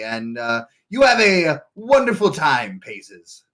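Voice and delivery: NPC voice. Acted. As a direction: shoo them off grandly. NPC dismissing the party.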